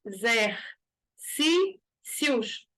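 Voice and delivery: "Exercícios" stretched very slowly, leaning on "cí"; slow syllable demo. Shown as a demonstration. Teacher spelling out the stressed syllable for students, very slow. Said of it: "penúltima sílaba de novo".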